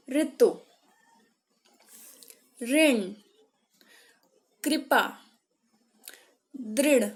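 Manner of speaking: words from "नृप" to "वृत्त" loud, isolated, with a clear "ri"; word reading drill. Shown as a demonstration. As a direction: read out "नृप", "ऋतु", "वृत्त" emphatically, one at a time. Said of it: "इसका उच्चारण करेंगे".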